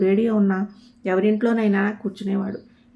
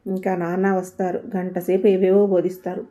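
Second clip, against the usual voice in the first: ordinary delivery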